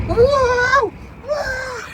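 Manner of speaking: high, loud alarmed yelp; startled whoa. Real, not acted. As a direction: cry out in sudden alarm. Sudden jolt in the storm.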